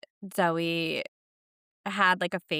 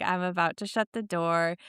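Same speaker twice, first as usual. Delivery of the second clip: ordinary delivery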